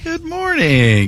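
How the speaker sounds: high, drawn-out greeting that swoops down; exaggerated greeting echo. Host echoing his co-host's morning greeting, exaggerated high and drawn-out.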